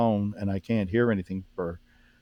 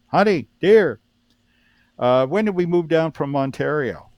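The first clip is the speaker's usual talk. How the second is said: higher, louder call to his wife; retold holler; 6 semitones higher, 6 dB louder than usual. Husband on the phone calls out to his wife, hollering. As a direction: holler through the house, easing off after the first words. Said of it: "I hear him holler for his wife".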